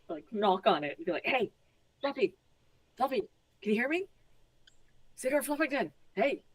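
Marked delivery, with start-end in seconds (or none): 1.3-6.4 higher-pitched calling out; mild yell at a mirror; mock yelling. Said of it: "I'm yelling at a mirror".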